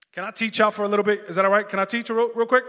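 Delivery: loud, urgent appeal to the room; calling out to congregation. Real, not acted. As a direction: fire the question out to the crowd. Calls out asking congregation's permission.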